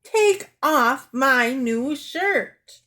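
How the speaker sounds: loud, very slow command; high, emphatic demand; angry order. Acted. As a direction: punch out each word separately.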